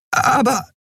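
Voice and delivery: high-pitched, stunned protest; lost for words, pitched high; speechless. A narrator's reading. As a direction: squeak out one baffled word. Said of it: "erlebte ihre Freundin zum ersten Mal sprachlos".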